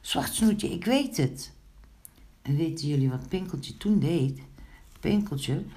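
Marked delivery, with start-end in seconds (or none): 0.0-1.5 high, eager exclamation; sudden call. A narrator's reading. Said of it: "opeens riep hij". (opeens enthousiast roepend)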